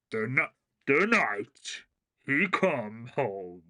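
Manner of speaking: slow, deep movie trailer announcer. Retold or imitated. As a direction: read it like an epic trailer voiceover. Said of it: "you can hear it in, like, the trailer guy's voice".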